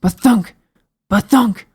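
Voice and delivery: booming thumps. Acted. Footsteps sound effect.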